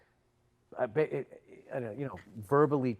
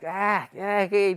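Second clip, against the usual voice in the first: higher, louder mimicry of a vendor; mocking imitation; 6 semitones higher, 6 dB louder, much slower than usual. Haggling customer imitating the camera seller, mockingly. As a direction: parrot the seller's protests mockingly. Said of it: "he was imitating the guy".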